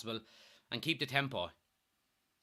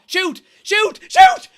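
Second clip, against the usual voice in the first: each yell higher and louder, ending in a screech; screaming shoot; 19 dB louder, much slower than usual. Real, not acted. Fan yelling at the player to shoot, screaming.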